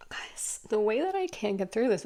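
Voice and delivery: real choked-up whisper; barely voiced, overcome with feeling; emotional. Real, not acted. Reader can't get through the story for viewers, emotional. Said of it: "without being emotional".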